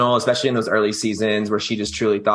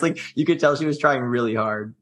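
ordinary talk, nothing marked

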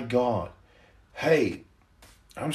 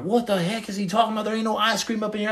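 higher and fast, mock confused onlooker; baffled bystander; 7 semitones higher, faster than usual. Bystanders reacting to the ice cream line, fast and baffled. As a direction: play the puzzled crowd, rushing it. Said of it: "everybody's like".

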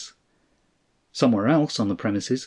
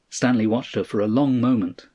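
usual speaking voice

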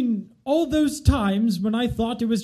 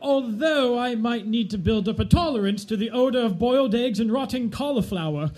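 ordinary talk

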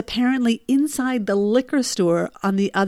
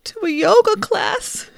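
much higher, slow tearful wail; a choked, high cry; 12 semitones higher, slower than usual. Retold or imitated. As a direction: blurt it through tears. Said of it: "And I cried".

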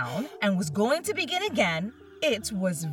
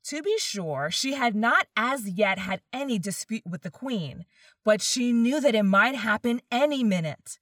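ordinary delivery, nothing marked

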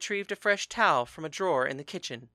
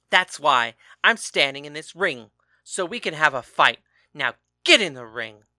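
angry shouting; 3 semitones higher, 8 dB louder than usual. A narrator's reading. Betrayed husband accusing his husband of lying, angry shouting. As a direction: rage at the betrayal.

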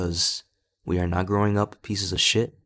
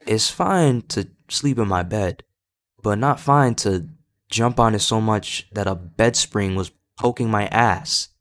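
normal talking voice

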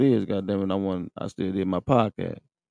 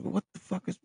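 disbelief; 11 semitones higher, 8 dB quieter than usual. Retold or imitated. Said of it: "I'm like".

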